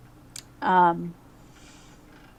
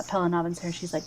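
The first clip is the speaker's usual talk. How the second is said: ordinary talk, nothing marked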